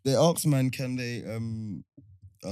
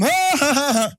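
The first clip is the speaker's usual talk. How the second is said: high, mocking fake laughter; mock a put-on voice-note laugh; 8 semitones higher, 4 dB louder than usual. Showing friend how faked voice notes start, mock laughing. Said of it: "voice notes with people laughing are fake".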